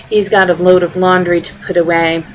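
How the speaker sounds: plain reading voice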